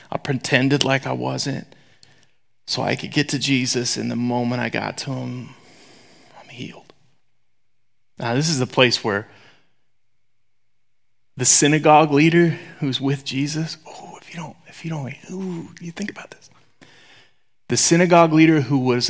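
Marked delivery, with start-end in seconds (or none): 0.1-6.9 whispered confession. Acted. 13.8-16.5 urgent hushed whisper; soft unvoiced whisper to the congregation. Real, not acted.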